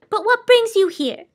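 high, dreamy lady savouring silence; enchanted, lilting high old lady; Soundkeeper voice. Acted.

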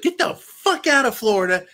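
pissed-off yell; angry shout. Real, not acted. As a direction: throw them out, furious. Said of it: "That's why I sound like I'm so pissed off".

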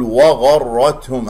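وغرتهم with a heavy و; wrong heavy waw. Shown as a demonstration. Teacher demonstrating the error to students, heavy waw. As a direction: say وغرتهم with thickened waw as the mistake. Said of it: "هذا خطأ".

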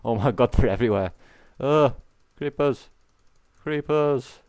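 high panicked yelps about creepers; panicked yelling. Real, not acted. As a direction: panic at the swarm.

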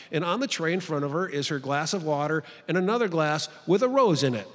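ordinary delivery

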